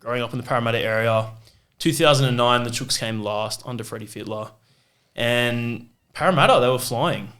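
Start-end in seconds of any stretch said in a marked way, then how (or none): none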